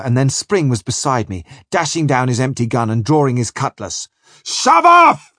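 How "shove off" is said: ずっと高く、大声で命令する; 舟を出せと部下に怒鳴る; bawled order. Acted. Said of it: "he bawled".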